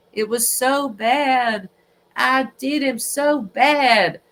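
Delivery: lightly put-on Southern accent, slow; a weak Southern drawl, imitating Murdaugh. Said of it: "I have a really bad Southern accent".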